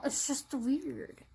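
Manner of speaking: slow, hushed whisper. Real, not acted. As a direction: breathe it out barely voiced. Tearful aside, voice drops away.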